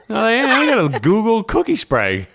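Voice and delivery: high, laughing delight. Real, not acted. Laughing excitedly about cookie spray.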